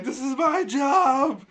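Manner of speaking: high, loud, drawn-out mock crying protest; hammed-up tearful yell; Ben Affleck. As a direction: wail it slowly, imitating a tearful hero. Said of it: "fucking Ben Affleck's crying".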